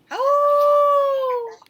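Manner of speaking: high held startled oh; mock surprised oh. Teacher pretending surprise at the kids' howl, high. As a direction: pretend the wolf scared you. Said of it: "a real wolf or something".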